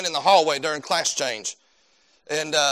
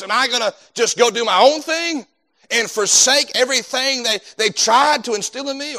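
louder, higher preacher's build-up; preaching at full force; 6 semitones higher, 7 dB louder than usual. Acted. Preacher to the congregation, pushing hard on the choice.

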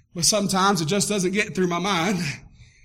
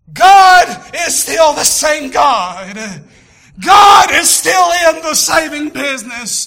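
loud, slow sermon climax; triumphant preaching; 7 semitones higher, 11 dB louder, slower than usual. Real, not acted. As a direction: proclaim it with fire. Preaching at full force.

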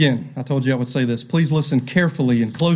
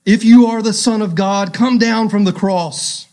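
loud, high jeering crowd voice; scornful jeering taunt, raised and high; mocking taunt; 5 semitones higher, 5 dB louder than usual. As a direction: sneer it up at the cross like a mocker. Mockers taunting Jesus on cross.

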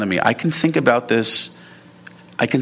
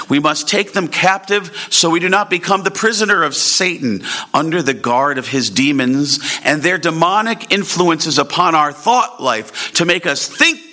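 impassioned preaching; 5 semitones higher than usual. Acted. Preacher warning the congregation about Satan, impassioned rising preaching. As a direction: pitch climbs higher into full preaching force.

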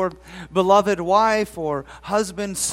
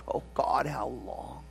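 anguished cry; 7 semitones lower, 9 dB quieter than usual. Acted. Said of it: "cries out".

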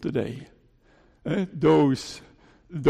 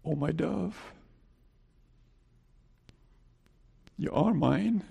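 very soft, loving address; hushed and tender, with a long pause; tender assurance; 9 dB quieter than usual. Acted. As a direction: speak it hushed, to a beloved. Voicing God's loving assurance.

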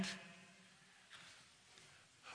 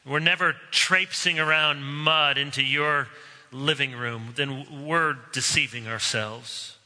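closing prayer; 3 semitones lower than usual. Acted. Pastor leads the congregation in a closing prayer, earnest. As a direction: pray it steadily and humbly.

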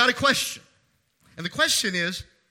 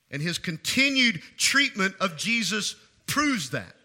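ordinary talk, nothing marked

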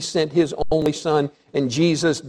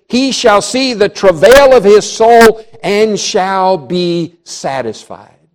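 loud, raised preaching voice; full-force preaching; 5 semitones higher, 6 dB louder than usual. Real, not acted. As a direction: proclaim it forcefully to the congregation.

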